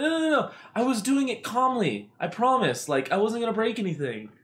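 high, rushed mock pleading; frantic pleading. Pleading with the fiance about the flipped room, high panicked voice. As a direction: squeak out a panicked excuse.

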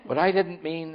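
a touch of storybook insistence; insistent. A narrator's reading. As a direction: protest as if misunderstood, lightly acted. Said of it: "insisted Milo".

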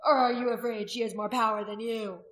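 loud, goading question; shouted challenge. A narrator's reading. Warrior goading the priestess, shouting. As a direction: challenge her at volume. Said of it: "Rolf shouted".

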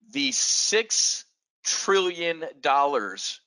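very slow, each word weighed; the six trillion dollars drawn out slowly for effect. Shown as a demonstration. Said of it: "Let me say that slower".

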